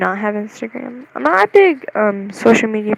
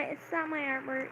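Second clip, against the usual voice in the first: very soft, higher-pitched voice; tiny admission; 5 semitones higher, 16 dB quieter than usual. Real, not acted. Owning up to viewers about the artwork, very quiet. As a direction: say it small, almost under the breath. Same denial, first tiny.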